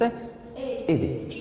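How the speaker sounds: E as "é", pause, then D; sound before consonant. Shown as a demonstration. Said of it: "on prononce E".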